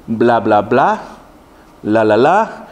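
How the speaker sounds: mock coaching syllables, low and evenly chanted; tongues coach demo. Fake coach teaching someone to speak in tongues, mock demonstration. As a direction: imitate someone prompting babbled syllables. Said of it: "Ok, hágale así, comience a decir".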